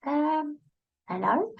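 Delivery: high, soft, tentative little voice; little gut voice. Acted. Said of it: "something in your gut goes".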